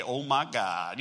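low, soft imitation of a Louisiana accent. Preacher imitates a church member praying, in a Louisiana accent. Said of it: "he had this Louisiana accent".

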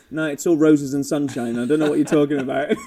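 breezy engineer voice, laughing through it; laughing mock engineer. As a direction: brush it off with a laugh, fast. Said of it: "And they're like".

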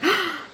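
sharp, high gasp of longing; awed gasp. Retold or imitated. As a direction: gasp at your dream car. Retold thrill at seeing dream car.

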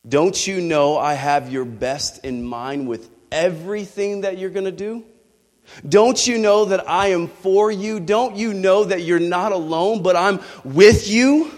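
building to loud, high-pitched insistence; fervent pleading questions, rising in force; impassioned reassurance. Retold or imitated. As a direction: drive each question home harder, stretching the last. God's reassurance builds in intensity.

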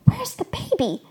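high, urgent hushed question; alarmed and high-pitched; panicked whisper. A narrator's reading. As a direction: ask it in a high, tight panic. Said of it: "I said in a panic whisper".

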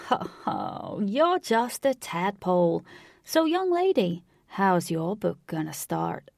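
slow Southern tease with a ho-ho; old-timer's Southern drawl, swooping up playfully; amused old Southern cowboy. A narrator's reading.

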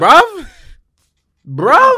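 high, loud disbelieving "Bruv?"; incredulous. Real, not acted. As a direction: shout it in disbelief at the rumour. Reacting to Arteta Real Madrid link.

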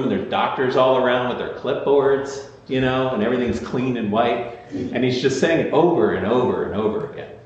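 usual speaking voice

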